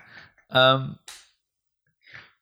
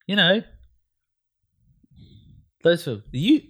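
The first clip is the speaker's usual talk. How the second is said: mock dismissive; 8 semitones higher than usual. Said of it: "teasing exaggerated voicing".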